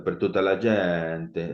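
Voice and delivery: slow mock Italian, stretched vowels; hammed-up Italian lilt; exaggerated Italian accent. Shown as a demonstration. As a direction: draw out gente in showy Italian. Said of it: "no, no hago eso".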